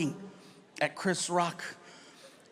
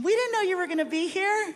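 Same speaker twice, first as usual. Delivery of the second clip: much higher excited voice; surprised fan; 11 semitones higher than usual. Retold or imitated. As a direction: gush like a starstruck stranger. People backstage greeting him.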